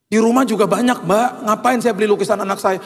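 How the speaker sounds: high-pitched, exasperated objection; indignant protest. Retold or imitated. Dad telling organizer why he won't buy, high indignant protest.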